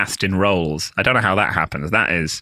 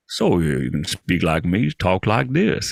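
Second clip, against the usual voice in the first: slow Southern drawl from Arkansas; Little Rock doorman; 5 semitones lower, slower than usual. Retold or imitated. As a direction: talk like an old Little Rock local. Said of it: "the doorman just was like".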